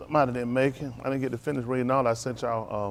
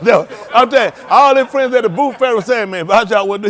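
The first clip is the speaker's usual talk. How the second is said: much louder and higher, laughing through the words; loud laughing retelling; 9 semitones higher, 14 dB louder than usual. Real, not acted. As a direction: belt it out, cracking up at the story. Laughing retelling of boot sale.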